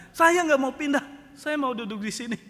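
loud, high, stubborn refusal; Pak Mukidi. Retold or imitated. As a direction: dig in angrily and refuse to budge. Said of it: "Pak Mukidi marah-marah". (ngotot marah, suara tinggi)

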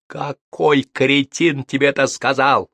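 slow, loud angry yell. A narrator's reading. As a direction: roar it at him, dragging it out. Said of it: "прорал Максим". (медленный злой крик)

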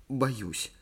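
small, slightly hushed admission; shuddering fear. A narrator's reading. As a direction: admit it with a little shudder. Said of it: "Ли вздрогнула". (робкое испуганное признание)

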